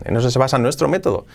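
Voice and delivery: ordinary talk, nothing marked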